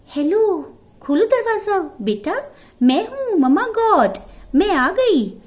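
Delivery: wolf sweetly pretends to be mother goat; wolf faking mother. Wolf poses as mother again. (मीठी ऊँची आवाज़ में माँ बना भेड़िया)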